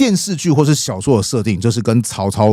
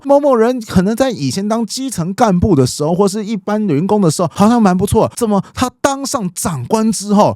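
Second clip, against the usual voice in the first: higher, puzzled voice of onlookers; baffled complaint; 7 semitones higher than usual. Retold or imitated. People gossiping about a promoted colleague who changed, baffled complaint. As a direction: imitate people wondering in a raised pitch.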